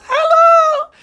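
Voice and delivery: very high, loud chirpy call; Silly's shrill hello. Acted. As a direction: call out like a happy little kid. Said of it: "she whistles".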